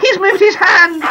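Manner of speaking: high, slow burst of amazed hope; a mother's breathless wonder; excited gasp. Acted. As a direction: cry out as the hand twitches. Mum sees son's hand move.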